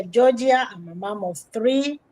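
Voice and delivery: usual speaking voice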